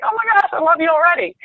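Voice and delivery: high, quick retold gush; delighted gushing. Retelling her instant liking for the caller, high gushing.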